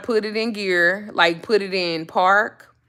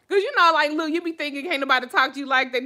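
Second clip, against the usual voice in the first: ordinary talk, nothing marked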